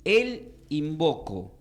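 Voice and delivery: very slow, measured title for note-taking; spaced out for people writing it down; slow dictation. Shown as a demonstration. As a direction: dictate it slowly, stretching each word. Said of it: "pongan así".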